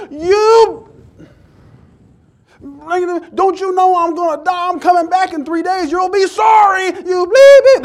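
hammed-up, high shouted tirade; going off. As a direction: blow up in mock fury, censoring the curses. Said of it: "I would have been going off".

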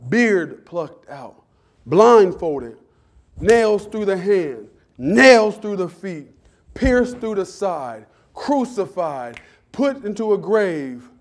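slow, each wound weighed; slow solemn list. Acted. Pastor listing Christ's wounds, slow and weighty. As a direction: lay each word down slowly.

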